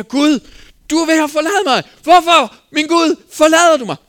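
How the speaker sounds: high, loud cry of abandonment; anguished cry. Acted. Jesus on the cross crying out to God, anguished shout.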